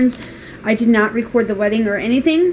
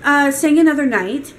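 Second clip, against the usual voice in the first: higher-pitched, curt rude questioning; retold rude demand, pitched up; rude maid; 5 semitones higher, 3 dB louder than usual. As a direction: imitate a rude hotel maid challenging a guest. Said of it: "and she's very rude".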